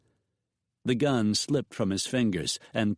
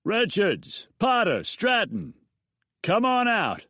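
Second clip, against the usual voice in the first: much higher, loud shout from a distance; calling from outside; 12 semitones higher, 4 dB louder than usual. A narrator's reading. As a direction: yell his name across the yard. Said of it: "The call came from outside the house".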